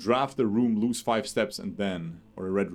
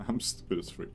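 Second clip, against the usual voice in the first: muttered under breath; 3 semitones lower, 9 dB quieter than usual. Real, not acted. Muttered self-insult after mistake.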